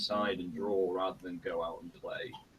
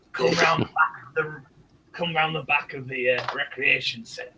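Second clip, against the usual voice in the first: louder, higher back-alley dealer; shady dealer voice; 5 semitones higher, 7 dB louder than usual. Retold or imitated. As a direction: pitch it like a dodgy street seller. Offers snuff like dodgy dealer.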